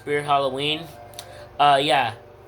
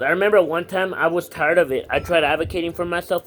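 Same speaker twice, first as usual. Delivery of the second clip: normal talking voice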